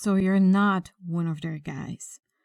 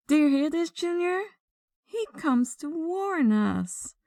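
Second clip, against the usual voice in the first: high-pitched, incredulous ridicule; mocking amusement; 8 semitones higher than usual. Gang boss jeers to Junior about being warned, mocking amusement. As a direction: share the joke, scoffing at the very idea.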